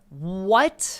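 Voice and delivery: loud, high, rising disbelief; incredulous. Real, not acted. Host hearing of the terroristic threat charge, incredulous. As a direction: burst out in real astonishment.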